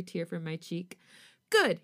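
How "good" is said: high, loud happy "Good"; husband exclaiming with a grin. A narrator's reading. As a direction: burst it out with a big grin. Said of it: "he exclaims".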